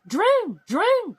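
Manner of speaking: high, loud call up the stairs. Acted. King searching for Droon, calling out loud and high.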